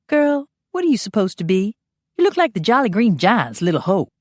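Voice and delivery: camp, bright greeting to a pal; sassy Phineas. Acted. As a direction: tease her like a cheeky friend. Phineas mocking the costume.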